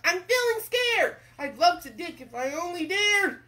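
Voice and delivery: high, loud cartoon worry; scared cook. Cook is asked to dig, hammed-up fright. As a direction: wail it in hammed-up fear.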